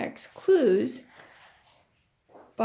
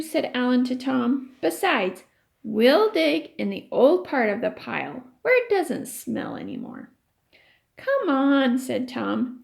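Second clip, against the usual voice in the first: lively read-aloud voice for the class; story reading; 2 semitones higher, 4 dB louder than usual. A narrator's reading. Teacher reading a chapter to her class, storytelling voice.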